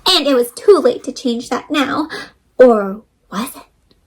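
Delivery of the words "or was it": breathy suspense whisper, slow; hushed whisper. A narrator's reading. Storyteller whispers a teasing question to kids. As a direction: whisper it like a secret twist.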